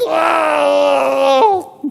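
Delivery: high, loud imitated scream of terror; falling scream. Preacher imitating the rebels falling into the abyss, screaming. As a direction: let out the cry of people dropping into the pit. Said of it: "They would have been screaming".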